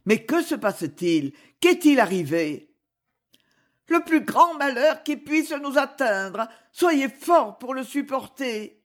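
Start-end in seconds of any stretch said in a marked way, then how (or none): 3.9-8.8 high-pitched, tragic delivery; grief-stricken. A narrator's reading. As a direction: break terrible news in anguish. Woman announces the great misfortune. (annonce tragique, voix aiguë et douloureuse)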